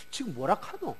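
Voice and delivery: a quiet, baffled Gyeongsang aside; 경상도 사투리로 조용히 중얼거리듯; Gyeongsang dialect. Retold or imitated. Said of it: "우리 경상도 사람이라고 할 것 같으면".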